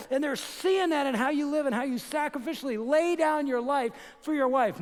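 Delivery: high, loud, rising sermon shout; preaching at full force. Real, not acted. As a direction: drive it home to the men in the room.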